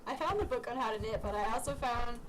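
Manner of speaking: ordinary talk, nothing marked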